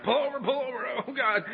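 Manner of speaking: high-pitched queasy panic, acted out; urgent plea. Passenger getting sick begs sponsor to pull over, urgent plea. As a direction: beg them to stop, about to be sick.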